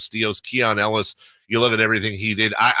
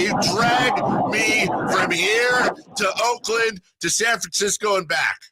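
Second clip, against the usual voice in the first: excited shouting; 11 semitones higher than usual. Real, not acted. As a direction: yell it like you're thrilled. Host begs caller to roast him.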